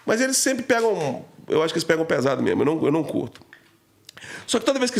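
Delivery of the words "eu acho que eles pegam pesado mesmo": ordinary delivery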